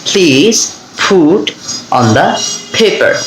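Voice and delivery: word by word, aspirated p in "Please", "put", "paper"; aspirated P drill. Shown as a demonstration. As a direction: separate each word and puff the p of "put" and "paper". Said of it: "আমরা আবার বলে দিচ্ছি". ("put", "paper" থেমে থেমে, ফ দিয়ে উচ্চারণ)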